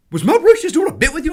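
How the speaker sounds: high, loud, excited rush; hammed-up astonished crowd voice; amazed question. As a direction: ask it bursting with disbelief. Everybody runs over, amazed.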